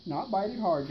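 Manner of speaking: ordinary delivery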